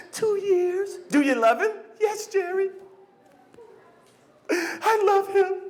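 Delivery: high, slow teary voice; mock crying guest. Talk show guest declaring love, high slow mock-crying voice. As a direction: sob it for the cameras.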